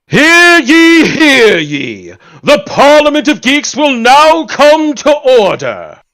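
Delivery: loud, high proclamation; town crier. Pretend. Calls the session to order.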